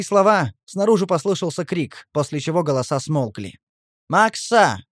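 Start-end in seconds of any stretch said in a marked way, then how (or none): 4.1-4.8 high, projected call; drawn-out shout of a name; calling from outside. A narrator's reading. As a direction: hail someone through a wall. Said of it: "послышалось снаружи".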